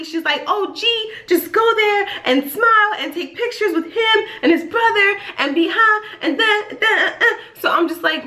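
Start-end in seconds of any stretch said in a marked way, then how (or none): 0.5-7.2 high-pitched gushing mom imitation; happy, excited mom. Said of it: "she's just so happy".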